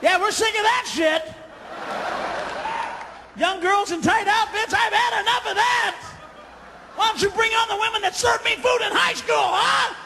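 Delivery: mock shouting reply to the ad; sarcastic shout. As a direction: yell it back with sarcasm. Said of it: "I go".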